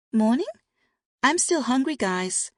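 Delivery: put-on high, loud little girl, impatient; a hungry kid nagging her parents, pitched high; impatient child. A narrator's reading. Daughter reminds her parents she is hungry, high and impatient. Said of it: "their daughter reminded them impatiently".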